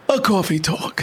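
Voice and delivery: high, showy tagline; announcer sign-off. Acted. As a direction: pitch it up like a show announcer.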